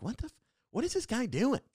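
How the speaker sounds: high-pitched, incredulous mock bystander; baffled onlookers. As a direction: imitate people puzzled by some weird guy. Onlookers baffled by his blow darting.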